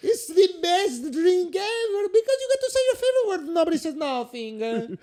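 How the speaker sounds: loud, laughing mock Italian accent; boisterous Italian host signing off. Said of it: "speaking in mostly that Italian accent".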